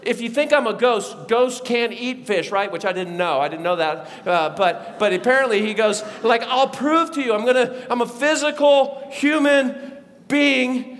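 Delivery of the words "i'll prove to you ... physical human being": raised, punchy declaration; emphatic insistence. Acted. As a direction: prove the point, loud and high. Jesus insists he is physical.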